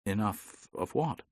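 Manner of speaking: soft probing question; softly. Acted. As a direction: ease the question in quietly. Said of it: "said Tanner softly".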